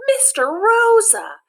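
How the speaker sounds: relieved cry. Acted. Kids greeting their returning advisor, joyful and loud. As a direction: shout his name with joyful relief.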